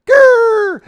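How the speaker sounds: very high, loud squeaky growl; a boy trying to roar and squeaking; tiny growl. Retold or imitated. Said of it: "He steps forward and says".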